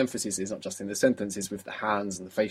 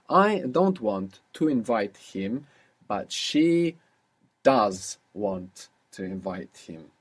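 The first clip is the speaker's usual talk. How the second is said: slow dictation; 2 semitones lower, much slower than usual. Shown as a demonstration. Teacher slowly dictating a sentence to translate. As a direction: spell it out slowly for the student. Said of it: "So how would you say that?".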